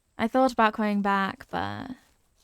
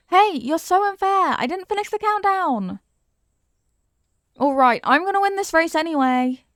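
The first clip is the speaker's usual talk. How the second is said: higher, louder protest called after a runaway; loud, high mock outrage; playful indignant shouting; 7 semitones higher, 5 dB louder than usual. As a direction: call out after the cheat racing ahead. Calling after a racer who cheated.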